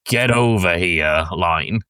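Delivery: ordinary talk, nothing marked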